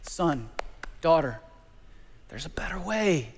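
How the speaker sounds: soft, hushed fatherly plea fading to a whisper; loving father. Retold or imitated. Jesus as a loving father pleading with his child, softly whispered. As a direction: lean in and whisper it like a gentle dad. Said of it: "like a loving father and just say".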